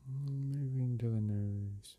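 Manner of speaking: unhurried meditation-guide voice with long pauses; guided meditation. Real, not acted. Meditation guide leading listeners through breath and release, calm and unhurried. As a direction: guide them gently through each breath, leaving space between phrases.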